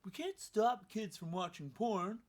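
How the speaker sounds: lightly voiced clueless idiot; mock idiot voice. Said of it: "some idiot, will say".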